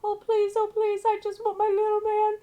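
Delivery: put-on very high, loud wailing child's voice; ghost boy. Ghost child crying out for his mama. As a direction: wail and beg like a lost child ghost.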